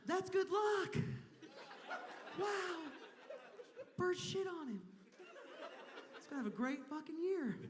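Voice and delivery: high, upbeat mock friend voice; mock cheerful friend. Comic imitating an upbeat friend about bird luck, high and laughing. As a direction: play the chipper friend with a laugh. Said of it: "another friend was like".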